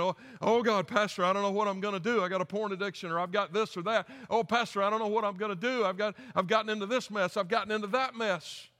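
a loud, high, pleading voice, partly hammed up; desperate caller. Caller phoning the pastor, mock desperate and pleading. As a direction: beg the pastor like a panicked sinner. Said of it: "And then they call me up".

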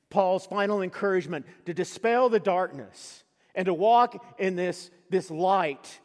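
loud, high, rousing preaching; preaching at full force. Acted. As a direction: lift the congregation to the climax. Climax of the sermon.

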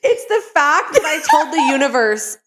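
high, laughing retelling; laughing through words. Real, not acted. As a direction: talk while laughing, high.

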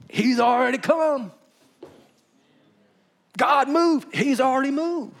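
loud, high-pitched rebuttal; emphatic preaching shout. Real, not acted. Preacher answers people asking God to come, emphatic shout. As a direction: hammer it home at full voice.

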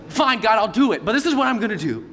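a hint of a pouting kid bargaining with God; sulky six-year-old. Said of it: "a six-year-old".

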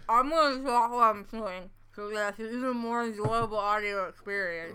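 muffled talk through a mouthful; words garbled round a bite of food; mouth full. Real, not acted. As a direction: keep talking while chewing on candy. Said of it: "I completely understand every word she's saying".

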